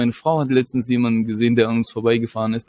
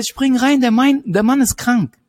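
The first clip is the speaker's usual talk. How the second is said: higher, louder, insistent repeat; louder police shout; 9 semitones higher, 4 dB louder than usual. Retold or imitated. Officer repeats the order, louder and more urgent. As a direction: bark it out a second time, harder.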